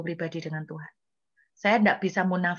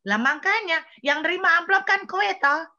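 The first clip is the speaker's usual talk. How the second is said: pressing accusation; 8 semitones higher, 5 dB louder than usual. Retold or imitated. God pressing her in a story.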